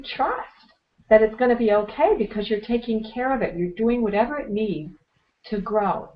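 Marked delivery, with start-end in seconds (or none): none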